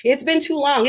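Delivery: usual speaking voice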